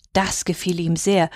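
ordinary delivery

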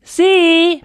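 a loud high call across the water; loud, high hail "See!"; shout. Acted. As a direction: call out as loud as you can to a distant boat. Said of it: "so laut ich konnte, zu rufen".

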